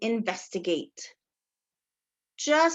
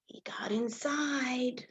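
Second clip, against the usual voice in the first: slow, secretive whisper; 8 dB quieter, slower than usual. Acted. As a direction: breathe it out slowly like a secret.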